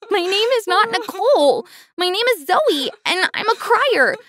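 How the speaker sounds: high-pitched, tearful protest; crying hard. A narrator's reading. Girl insists on her real name to new mom, crying hard. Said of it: "I began to cry even harder".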